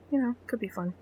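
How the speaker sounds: sarcastic whisper; whispered aside. Real, not acted. Host whispering a snarky concession to co-host. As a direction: breathe it as a snide aside.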